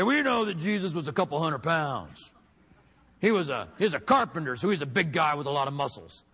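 mock preacher declaiming to the crowd; preacher impression. Quoting a preacher on how big Jesus was, mock declaiming. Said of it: "I heard a preacher say".

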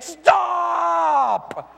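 sharp high parent shout; mock urgent shout at a kid; parent yelling stop. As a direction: yell to stop a child running at a cliff edge. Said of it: "You would yell at your kid".

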